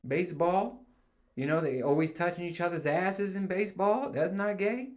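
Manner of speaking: high, rising, needling questions; teasing mock question. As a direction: tease with each phrase lifting at the end.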